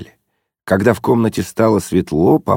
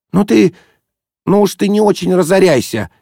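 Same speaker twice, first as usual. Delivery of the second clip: sharp rebuke; 13 semitones higher, 3 dB louder than usual. A narrator's reading. Зося осаживает жениха.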